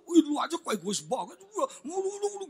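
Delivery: high-pitched mock bickering; quarrelling husband. Husband accuses wife of selfishness, mock quarrel. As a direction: nag fast into a garbled tail. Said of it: "ribut tuh".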